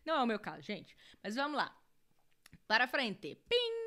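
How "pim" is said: "pim" like a little bell; ding to move things along; imitated chime. Shown as a demonstration. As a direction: make a short chime sound. Chime noise moving to next question.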